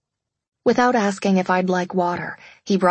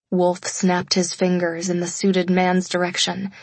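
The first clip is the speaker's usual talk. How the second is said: usual speaking voice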